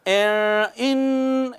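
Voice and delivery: ان with a light hidden nasal; gentle ikhfa hum. Shown as a demonstration. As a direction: keep ان only lightly nasal. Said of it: "اب اخفا ہے".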